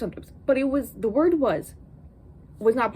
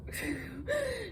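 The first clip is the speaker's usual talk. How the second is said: exasperated laugh; 6 semitones higher, 10 dB quieter than usual. Real, not acted. Laughing about the love triangle.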